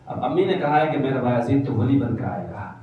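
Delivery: ordinary delivery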